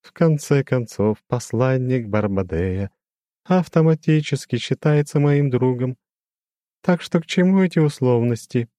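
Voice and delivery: lofty demon with a glassy ring; crystal ringing voice. A narrator's reading. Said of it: "прозвенел демон своим хрустальным голосом". (высокий звенящий хрустальный голос демона)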